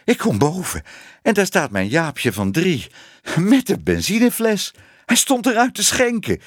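high, agitated, panting account; hijgend. A narrator's reading. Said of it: "hijgde ze". (hijgend en steeds hoger van opwinding)

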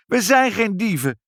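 high, loud indignant cry; shouting. A narrator's reading. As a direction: cry out in protest. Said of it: "schreeuwde Ibbeltje".

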